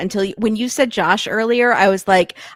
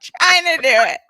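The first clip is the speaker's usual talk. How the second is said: laughing; 9 semitones higher than usual. Real, not acted. Laughing while retelling.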